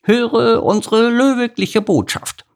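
high, slow proclamation; pompous decree. Acted. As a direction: announce it grandly.

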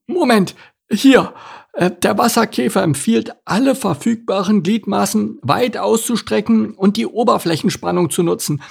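high, flustered turtle voice, hesitant and halting; a turtle leafing frantically through notes; high, stumbling panic; panicky. Acted.

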